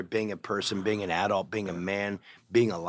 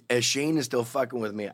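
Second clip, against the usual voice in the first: usual speaking voice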